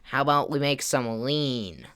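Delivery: slow, drawn-out sarcastic suggestion; most sarcastic voice. Retold or imitated. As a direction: imitate a friend dragging out a sarcastic idea. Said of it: "in a the most sarcastic voice ever".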